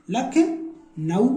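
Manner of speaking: लखनऊ stretched very slowly, each syllable apart. Shown as a demonstration. Teacher reading लखनऊ slowly to the class. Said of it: "पढ़ो जरा".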